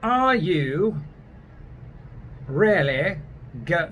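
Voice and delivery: deliberate British demo, words set apart; snooty British English; British accent. Shown as a demonstration. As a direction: spell out the British phrase piece by piece. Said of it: "if you want to be snotty".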